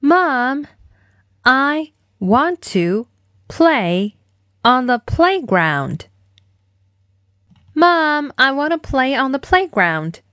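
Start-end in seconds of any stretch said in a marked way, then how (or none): 0.0-6.1 slow, word-by-word model line; spaced-out demo for kids to repeat; slow follow-along model. Shown as a demonstration. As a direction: say it slowly, one chunk at a time. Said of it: "一起来练习今天的跟读作业".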